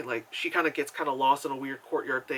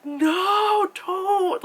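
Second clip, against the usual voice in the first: high, drawn-out wail; pained protest; 4 dB louder than usual. Retold or imitated. Viewer begging the lady on screen, wailing protest. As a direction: stretch it out, pleading and high. Pleads with the character while watching.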